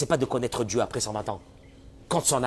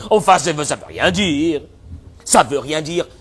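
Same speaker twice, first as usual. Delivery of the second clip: loud, exasperated objector's voice; mock protest; 2 semitones higher, 8 dB louder, slower than usual. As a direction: protest loudly, as a baffled objector. Acted protest of an incredulous objector. (objection exaspérée, jouée, plus forte)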